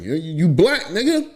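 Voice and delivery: mock yell with a high final word; mock retort. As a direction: tease a friend in a retold argument. Retold argument with friends.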